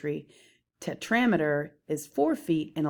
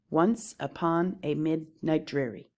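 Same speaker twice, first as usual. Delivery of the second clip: measured trochaic beat, ONCE, PON, MID, DREA stressed; metrical stress. Shown as a demonstration. Teacher scanning The Raven, stressed then unstressed syllables. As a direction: scan "Once upon a midnight dreary" foot by foot. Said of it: "mark up the scansion of these examples".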